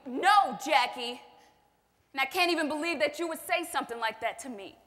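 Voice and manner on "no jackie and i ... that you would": sharp, louder protest; loud denial. Acted. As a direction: push back hard on the accusation. Denying abuse, caught out.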